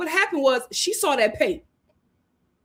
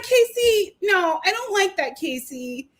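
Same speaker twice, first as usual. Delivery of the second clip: higher-pitched imitation of a tearful complaint; mock tearful whine; 6 semitones higher than usual. Upset girlfriend scolds her joking boyfriend, mock tearful whine. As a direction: whine it like a put-upon girlfriend. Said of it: "Anaya is in tears".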